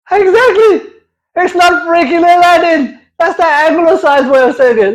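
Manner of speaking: high, emphatic protest; high indignant rant. Real, not acted. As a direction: insist with mock outrage. Indignant about name.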